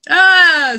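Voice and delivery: loud, high "ah" of mock frustration; exasperated cry. Narrator catching her own wrong accent, exasperated cry. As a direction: burst out in mock dismay.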